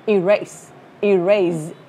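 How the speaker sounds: light first syllable, strong "RASE"; stress on second syllable. Shown as a demonstration. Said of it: "Nhấn âm hai".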